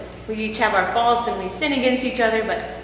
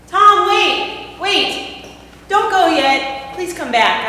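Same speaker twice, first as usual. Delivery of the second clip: calling after him; 7 semitones higher, 7 dB louder, much slower than usual. Acted. Calls after husband leaving.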